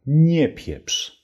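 "Nie pieprz" with a high stressed "Nie"; polite retort. Shown as a demonstration. Teacher showing a polite don't-talk-nonsense. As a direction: toss off "Nie pieprz" as a light rebuke. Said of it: "kannst du auf höfliche Art und Weise sagen".

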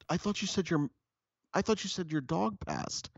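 high, confused pet-talk imitation. Partner asking which loss it was, high mock pet-talk. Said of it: "talking to people like they're, like they're pets".

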